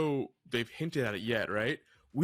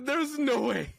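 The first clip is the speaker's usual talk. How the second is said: higher and louder, still laughing; laughing disbelief; 13 semitones higher, 5 dB louder, slower than usual. Real, not acted. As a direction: blurt it out through the laugh. Laughing at an open secret.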